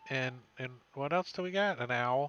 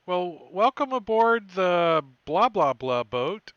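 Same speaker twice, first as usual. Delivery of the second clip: hearty captain voice; 4 semitones higher, 9 dB louder than usual. Acted. Captain welcoming the crew.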